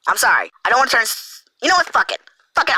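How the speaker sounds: usual speaking voice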